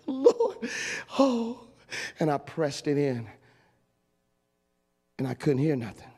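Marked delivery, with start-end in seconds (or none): 0.1-1.7 high, laughing oh Lord; laughing relief. Retold or imitated. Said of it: "And I was like".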